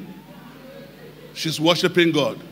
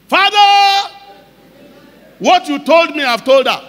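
loud, much higher cry of "Father", then pressing on; fervent prayer; 8 semitones higher, 8 dB louder than usual. Retold or imitated. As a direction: pray out loud to God in full earnest. Retold prayer to God.

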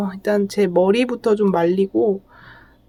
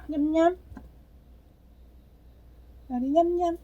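high, soft cooing to a pet; squeaky praising a dog; 5 semitones higher, 6 dB quieter than usual. Real, not acted. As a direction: coo sweetly to a small dog. Praising dog during brushing.

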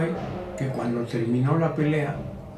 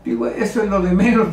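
ordinary delivery

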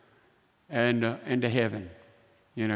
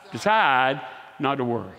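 normal talking voice

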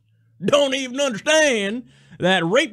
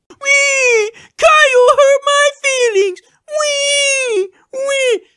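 put-on much higher bratty kid crying; whiny child wail; 13 semitones higher, 4 dB louder, slower than usual. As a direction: wail like a hurt toddler.

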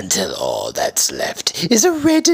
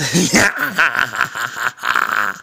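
evil cackle. Acted. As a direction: cackle with wicked glee. Goblin villain laughing.

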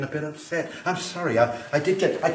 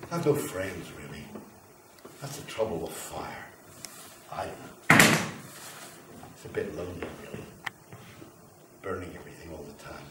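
lonely confession; 9 semitones lower, 8 dB quieter, faster than usual. Acted. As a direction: lower and softer, a touch of self-pity.

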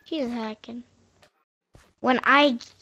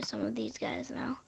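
hushed sneaky whisper; 12 dB quieter than usual. Real, not acted. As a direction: whisper so nobody hears. Sneaking up on players.

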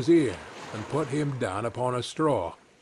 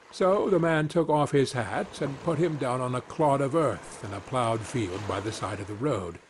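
plain reading voice

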